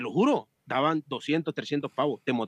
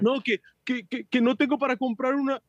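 mock whine; 7 semitones higher than usual. Imitating frustrated cyclist's excuses.

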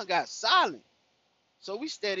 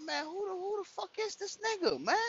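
higher pitched disbelief, who the heck is this; rising outraged disbelief; incredulous; 11 semitones higher, 4 dB quieter than usual. Retold or imitated. He asks angrily who this is.

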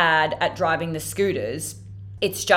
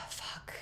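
exasperated sigh; 21 dB quieter than usual. Real, not acted. Despairing sigh over the crash.

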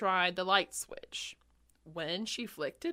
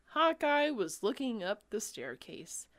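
ordinary delivery

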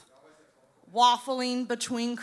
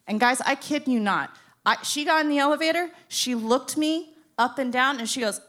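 ordinary delivery

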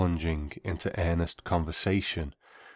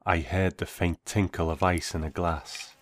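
ordinary delivery, nothing marked

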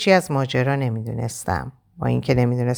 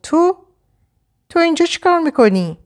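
much higher, shocked and scared; shocked trembling voice; 14 semitones higher, 4 dB louder than usual. Acted. Shadi startled to find the man at her door, frightened. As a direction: gasp it out, seeing him there. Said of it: "با صدای لرزون گفت".

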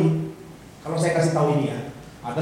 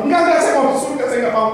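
mock hurried refusal; 5 semitones higher, faster than usual. Refusing offered wealth.

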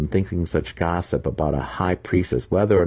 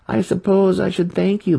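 much higher, mock polite; mock thanks; 6 dB louder than usual. A narrator's reading. Apprentice taunts the trapped wizard, mock polite. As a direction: pretend gratitude. Boy mocks captive.

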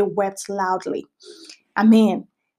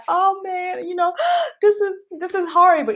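high, tearful mock wail with a gasp; hammed-up weeping, pitched up; mock crying; 8 semitones higher than usual. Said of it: "It was a loud kind of cry".

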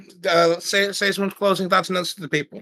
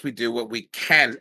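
normal talking voice

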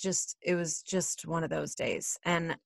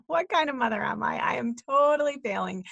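higher, exasperated mock despair; hammed-up panic at yourself, pitched up; mock self-reproach; 6 semitones higher, faster than usual. Mom berating herself over the screaming match, mock self-reproach.

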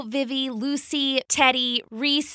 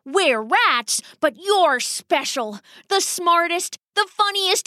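higher-pitched, gushing papa rat; dramatic rat father; 4 semitones higher than usual. Acted. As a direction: heap extravagant praise on a daughter, theatrically. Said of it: "familiar with her father's dramatics".

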